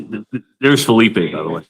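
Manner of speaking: normal talking voice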